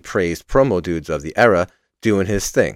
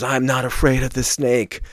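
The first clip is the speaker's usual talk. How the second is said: retold scream; 6 semitones higher than usual. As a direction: push it up in pitch like a half-scream. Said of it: "he screams".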